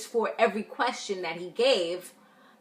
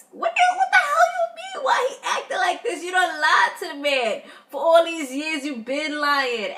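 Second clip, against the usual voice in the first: much higher and louder indignant outburst; outraged disbelief; 7 semitones higher, 4 dB louder than usual. Real, not acted. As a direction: erupt at the liar in disbelief. Outraged at her lying.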